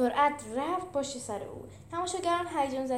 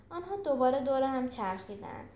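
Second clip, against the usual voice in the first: plain reading voice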